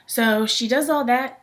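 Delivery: ordinary delivery, nothing marked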